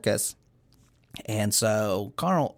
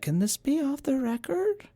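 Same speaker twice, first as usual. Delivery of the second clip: high squeaky voice. Retold or imitated. Macy voiced, conspiratorial.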